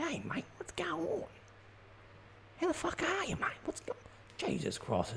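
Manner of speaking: high, soft hushed ocker banter; lightly voiced Aussie dad bumping into a mate; parents chatting with a workmate. Parent greeting a workmate outside the shops, hushed high bloke voice. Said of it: "your parents would run into someone from work and they'd be like".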